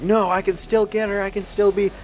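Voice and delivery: stubborn younger self. Retold or imitated. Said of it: "Back in the day, I would just be like".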